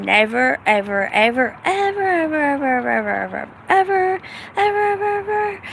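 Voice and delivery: ever après ever, montant de plus en plus aigu; over-the-top emphatic never. Real, not acted. Emphatic refusal of corporate work.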